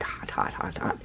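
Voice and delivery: breathy whisper, hushed and secretive. Real, not acted. Showing off the hot pink dye to viewers, whispered.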